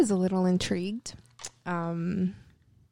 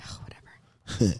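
whispering; 13 semitones lower, slower than usual. Real, not acted. Dismissive reply to teasing.

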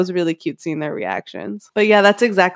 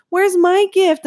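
put-on kid brother; 11 semitones higher, slower than usual. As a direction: pipe up like a jealous kid brother. Brother voiced in a story.

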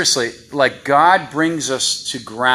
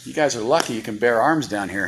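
normal talking voice

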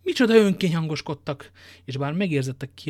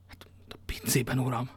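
timid, softer whisper; 2 semitones lower, 7 dB quieter than usual. Acted. Innkeeper timidly admits to D'Artagnan where Athos is, whispering. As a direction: whisper the answer fearfully.

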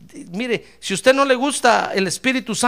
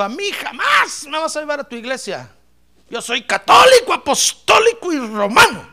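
loud, higher mock of the brother's defiance; defiant brother; 5 semitones higher, 5 dB louder than usual. Preacher imitating his brother refusing church, loud mock defiance. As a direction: throw the stubborn refusal back, hammed-up.

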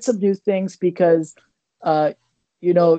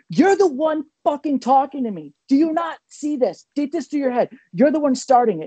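angry retort; 6 semitones higher than usual. Retold or imitated. Retold onstage argument with heckler.

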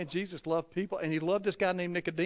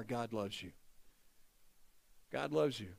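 dropped low and very soft; tender reassurance; 8 semitones lower, 12 dB quieter than usual. Real, not acted. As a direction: quietly reassure the congregation. Soft close of repeated declaration.

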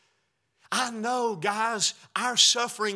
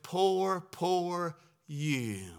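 mock pity; 4 semitones lower, 7 dB quieter, much slower than usual. Mocking pity-song culture.

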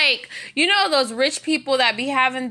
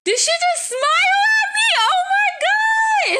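amazed shout; 3 dB louder than usual. Retold or imitated. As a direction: shout in disbelief as the dog smiles at you. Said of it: "I was like".

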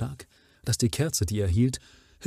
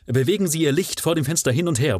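higher, fast and loud command; urgent call; 7 semitones higher, 5 dB louder, faster than usual. A narrator's reading. The Baron urges Watson at the window, eager call. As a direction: hurry him to wave the light. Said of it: "rief der Baron".

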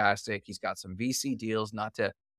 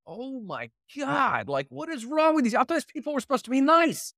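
high-pitched, mock-incredulous complaint building louder; exasperated outburst; 13 semitones higher, 4 dB louder than usual. Traveller venting about supposedly nice Canadians, exasperated. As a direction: whine it way up high, ending loud and fast.

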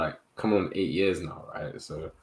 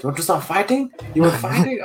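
high, raised-voice mock challenge; confrontational; 9 semitones higher, 5 dB louder than usual. Shopkeeper challenging customer to a fight, imitated aggression. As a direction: act out an angry challenge, higher and louder. Shopkeeper's threat acted out in retelling.

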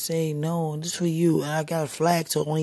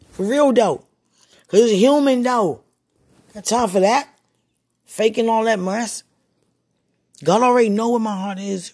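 high, loud self-justifying outburst; insistent protest; 6 semitones higher, 7 dB louder than usual. Real, not acted. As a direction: insist loudly, pitch well up. Protesting that feelings are human.

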